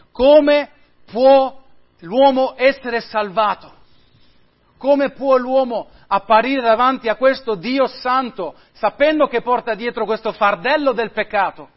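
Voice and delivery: high, loud preacher's question; preacher thundering the big question at the congregation; ringing question. Real, not acted. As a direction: proclaim it to the whole room. Preacher poses the central question.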